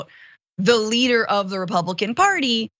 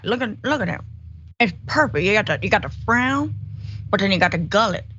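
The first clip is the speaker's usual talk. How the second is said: Mitch McConnell. Acted. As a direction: drag out key words in a hammed-up senator impression. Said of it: "I could do a very good Mitch McConnell".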